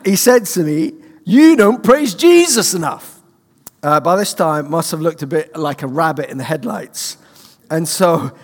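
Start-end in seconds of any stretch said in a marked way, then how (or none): 1.2-3.2 put-on high, loud, slow Kansas accent. The Kansas doctor scolding the young student, in accent. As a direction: stretch it out like the Kansas prophet. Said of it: "in a Kansas accent".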